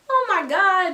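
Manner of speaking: high, loud, drawn-out exclamation; mock swooning. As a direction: act out being thrilled by a crush. Retold childhood thrill over her crush.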